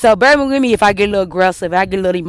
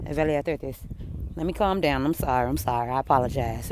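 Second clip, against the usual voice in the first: dropping much softer and lower to calm down; calming herself down; 8 semitones lower, 10 dB quieter, slower than usual. Real, not acted. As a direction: pull it right down, settling yourself.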